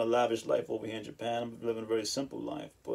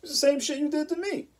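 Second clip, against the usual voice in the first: much higher put-on voice of fake shock; mock shocked person. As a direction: play the hypocrite acting stunned. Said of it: "they act so shocked".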